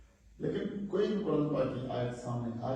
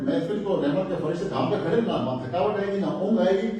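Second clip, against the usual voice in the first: louder, higher sermon build; fervent sermon passage; preaching with rising fervor; 7 semitones higher, 10 dB louder than usual. Acted. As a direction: lift the crowd along.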